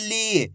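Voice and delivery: "ली" with a long, held ee; drawn-out vowel. Shown as a demonstration. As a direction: stretch out the vowel of "ली". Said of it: "ला का उच्चारण कैसे कर रहे हैं".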